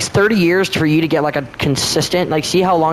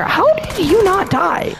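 incredulous high pitch; slower than usual. Real, not acted. Zombie survives shot.

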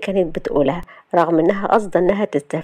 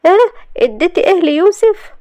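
high, loud startled question; shocked; 11 semitones higher, 5 dB louder than usual. A narrator's reading. Salma reacting to news about the laundry, shocked. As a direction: blurt it out in shock, pitch leaping. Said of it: "وفجأة اتحولت الابتسامة لصدمة".

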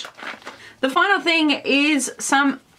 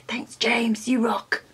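whispering; 4 dB quieter, slower than usual. Acted. Prompting viewers to thank James.

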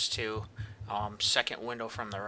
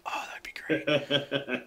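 whisper; 4 semitones higher, 4 dB louder, much slower than usual. Real, not acted. Joking about stream sniping.

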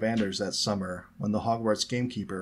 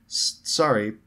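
stammered, louder apology; anxious; 6 dB louder, slower than usual. A narrator's reading. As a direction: trip nervously on the first sound. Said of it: "looking anxious".

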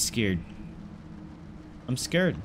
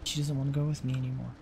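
mock sulk; 5 dB quieter than usual. Real, not acted. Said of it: "Do you not wanna go".

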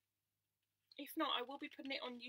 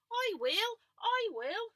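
much higher, louder eager call; eager volunteering; 12 semitones higher, 15 dB louder, much slower than usual. Acted. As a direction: jump in eagerly as Missus Cow.

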